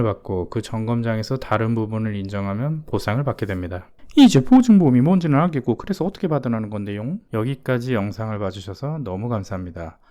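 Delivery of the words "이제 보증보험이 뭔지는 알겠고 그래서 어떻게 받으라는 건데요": loud, high start rushing into the question; impatient viewer. Acted. Viewer pressing the host on how to get paid, impatient. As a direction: cut in like a fed-up viewer.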